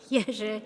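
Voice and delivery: "Jerzy" as YEH-zheh, unlike New Jersey; Polish pronunciation. Shown as a demonstration. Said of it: "Not New Jersey, please, but".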